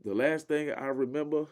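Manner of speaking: normal talking voice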